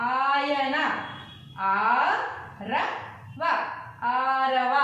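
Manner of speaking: very long ఆ opening ఆన, ఆమడ, ఆరవ; long vowels. Shown as a demonstration. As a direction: draw out the ఆ in ఆట, sliding up, first split then joined. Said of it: "అన్నీ మనం దీర్ఘాలతో పలికాం".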